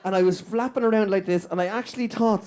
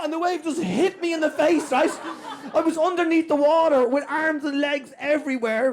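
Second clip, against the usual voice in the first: normal talking voice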